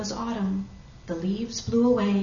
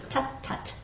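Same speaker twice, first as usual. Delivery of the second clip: hushed, dismissive tut-tut; very quiet little tuts; soft tutting; 11 dB quieter than usual. A narrator's reading. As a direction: brush off the objection under your breath. Maisie soothing away the elephant's objection.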